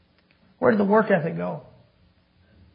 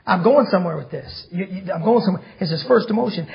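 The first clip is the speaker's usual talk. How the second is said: usual speaking voice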